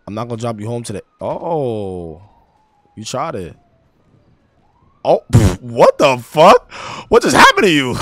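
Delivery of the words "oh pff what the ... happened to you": loud startled disbelief; shocked outburst. Real, not acted. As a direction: react in real shock, loudly. Friend suddenly passes out.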